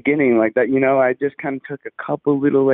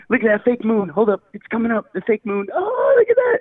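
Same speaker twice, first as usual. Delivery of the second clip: high, excited mock exclaim; imitating excited host; 10 semitones higher than usual. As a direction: squeal it like the hyped host. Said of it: "you had this one clip where you're like".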